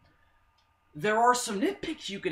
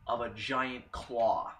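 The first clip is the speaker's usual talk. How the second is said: normal talking voice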